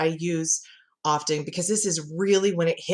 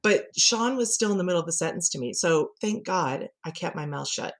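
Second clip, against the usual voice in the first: ordinary delivery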